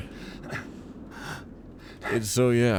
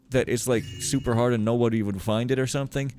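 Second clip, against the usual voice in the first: usual speaking voice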